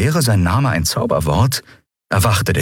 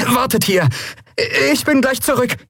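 put-on much higher, urgent boy's voice; young boy, hurried. A narrator's reading. Young Miko tells the others to wait, urgently. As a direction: rush the order out nervously.

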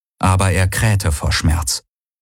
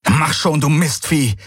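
higher, cursing the animal; exasperated; 7 semitones higher than usual. A narrator's reading. Galen swears at the stubborn bird, exasperated.

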